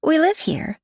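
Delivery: put-on very high child voice; little girl. A narrator's reading. Sasha continues.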